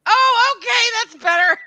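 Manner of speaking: high, loud "oh, okay" of relief; relieved realization. Retold or imitated. Streamer retelling their reaction to reading the caption, relieved exclamation. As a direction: exclaim it loud and high as it suddenly makes sense. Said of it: "and I'm like".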